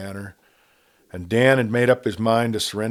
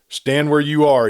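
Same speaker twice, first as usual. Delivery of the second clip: loud, higher sharp order; commanding; 8 semitones higher, 9 dB louder than usual. A narrator's reading. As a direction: halt him with one command. Dan halting the outlaw at night.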